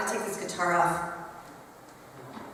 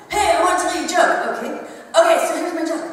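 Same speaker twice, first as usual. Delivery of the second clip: put-on eager kid; 4 semitones higher than usual. Teacher voicing a boy telling her a joke. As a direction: pitch up like a scrappy little joker. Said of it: "he's like".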